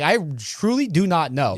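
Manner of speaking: ordinary talk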